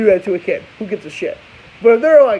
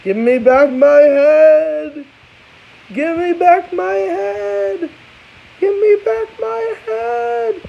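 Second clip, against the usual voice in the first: slow, rising wail climbing higher each time; drawn-out ghostly cry, building higher; ghost shouting; 10 semitones higher, much slower than usual. Retold or imitated. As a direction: moan it out like a headless ghost.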